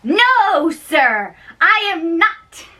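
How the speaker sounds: high, sharp denial of the insult; a servant hotly denying he means the insult; indignant denial. Acted. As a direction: throw the accusation back, pitched high. Servant denies in quarrel.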